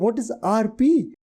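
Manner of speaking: high, slow, baffled "what is R P"; a student puzzled by an unfamiliar term; baffled question. Retold or imitated. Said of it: "I'm like".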